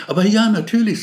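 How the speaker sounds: high, loud, eager agreement; a bright, keen yes, right away. A narrator's reading. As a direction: jump at the offer with delight.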